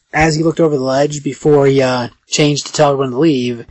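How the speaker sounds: usual speaking voice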